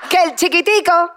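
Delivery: Cuban sales pitch; Cuban accent. Shown as a demonstration. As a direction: sell it like a Cuban TV host. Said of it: "Eso sería cubano".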